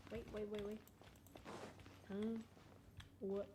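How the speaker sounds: ordinary talk, nothing marked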